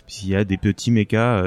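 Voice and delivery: normal talking voice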